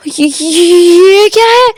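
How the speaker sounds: high, very slow stammered cry; stunned, crying disbelief at the mirror; shocked cry. A narrator's reading. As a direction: gasp out the words in horror. Wing sees a stranger's face.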